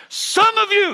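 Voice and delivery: high, very loud drawn-out yell; yelling preacher. Retold or imitated. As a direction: bellow it like a revival preacher. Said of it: "he's yelling".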